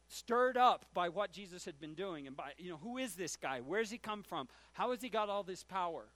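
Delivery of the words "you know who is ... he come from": usual speaking voice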